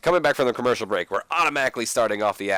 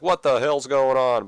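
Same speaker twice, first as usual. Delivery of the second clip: confused wrestlers; 3 semitones higher, 5 dB louder than usual. Acted. Wrestlers confused by Cena's entrance.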